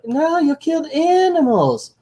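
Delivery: very high mock kid voice; whiny cartoon kid. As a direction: protest in a hammed-up, high-pitched child voice. Cartoon kid protesting, voiced by reviewer.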